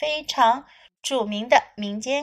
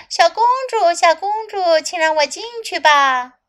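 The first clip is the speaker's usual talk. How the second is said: high, loud calling frog; 6 semitones higher, 4 dB louder than usual. Acted. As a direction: call sweetly through the door. Frog calls in translation.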